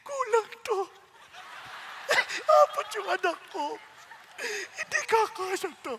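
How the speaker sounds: high, crying, half-laughing; telling God the money is short; tearful complaint. Retold or imitated. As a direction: whimper it through tears. Crying over insufficient money.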